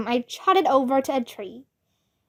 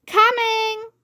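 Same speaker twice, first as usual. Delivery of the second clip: shouted call; 9 semitones higher than usual. A narrator's reading. Girl answering the teacher's whistle, shouted call. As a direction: call out across the yard. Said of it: "shouted Yellowy".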